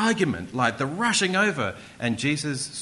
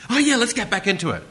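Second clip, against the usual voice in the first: loud, higher burst back into action; mock resuming; 8 semitones higher, 6 dB louder, faster than usual. Mocking a film resuming its action sequence, suddenly loud and eager. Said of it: "You wouldn't go".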